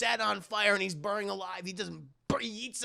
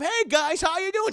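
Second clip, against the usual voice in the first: high, loud cheery greeting; oblivious sing-out hello; Detective Bobbity Boop; 11 semitones higher, 6 dB louder than usual. Acted. Detective walks in on the scene, high loud greeting.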